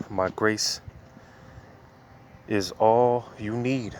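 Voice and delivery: "My grace is all you need" drawn out slowly, each word weighted; "My grace is all you need" stretched slowly with solemn weight; slow scripture reading. Acted. Verse read slowly, then repeated plainly.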